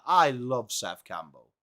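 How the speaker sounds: normal talking voice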